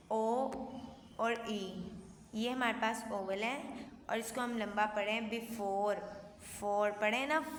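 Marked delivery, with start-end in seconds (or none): none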